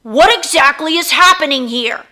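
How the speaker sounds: loud stern demand; shout. Acted. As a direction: demand an answer firmly. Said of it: "he shouted".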